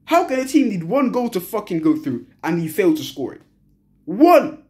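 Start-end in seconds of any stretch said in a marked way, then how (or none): 4.0-4.6 loud single-word yell; shout. Real, not acted. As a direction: shout it out in frustration. Fan shouting about one goal.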